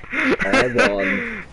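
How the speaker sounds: high, laughing cry of triumph; laughing victory shout. Real, not acted. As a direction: crow about winning. Celebrating a game win.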